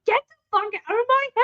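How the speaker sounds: high, quick imitation of a cartoon kid; lightly voiced kid, rushed and high. Cartoon kid dismissing her friend, quick high imitation. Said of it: "she's just like".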